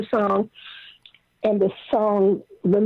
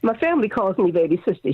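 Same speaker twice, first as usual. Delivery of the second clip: normal talking voice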